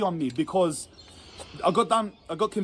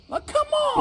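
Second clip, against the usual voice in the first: much higher exasperated cry. Real, not acted. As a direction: throw it up high in frustration. Protesting unfair guilty verdict.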